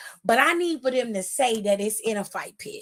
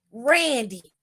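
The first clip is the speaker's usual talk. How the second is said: ordinary talk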